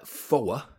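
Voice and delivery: foa with an open, dropped-r Bolton vowel; old Bolton accent, foa kept distinct. Shown as a demonstration. Demonstrating the old Bolton four sound. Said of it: "I would have said".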